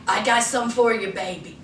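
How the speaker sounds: low, soft seductive man voice; hammed-up romantic whisper. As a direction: drop it low and smooth like a lover. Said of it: "He was like".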